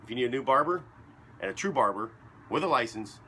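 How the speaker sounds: punchy announcer plug; sponsor plug. Acted. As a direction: sell the barbershop like a radio spot.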